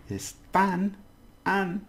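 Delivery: "están" with a high, long final syllable, then "han" alone; stress on TAN. Shown as a demonstration. Teacher says we've got to stress, high TAN in "están". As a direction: lift the last syllable of "están" and echo it. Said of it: "we've got to stress".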